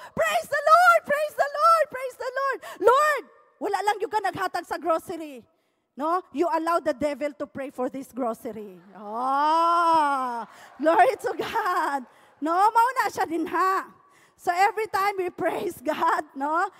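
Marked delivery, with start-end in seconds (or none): 0.1-5.5 high, loud jubilant shouts fading; ecstatic praise. Retold or imitated. Woman jumping for joy praises the Lord. 9.0-12.1 loud, tearful shout of glory; crying out praise. Acted. Preacher crying out glory to God before the congregation.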